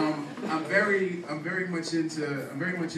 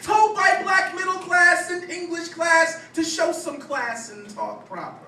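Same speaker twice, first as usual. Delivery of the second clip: higher, louder, rhythmic slam-poem delivery; spoken-word poetry; 7 dB louder than usual. Acted. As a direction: perform it as a punchy stage poem.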